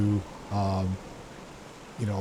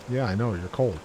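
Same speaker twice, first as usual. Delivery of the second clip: normal talking voice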